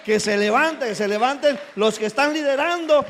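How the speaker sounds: loud, rising preacher's exhortation; preaching at full force. Real, not acted. Pastor urging the congregation's leaders to rise, loud preaching. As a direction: call the leaders up with fire.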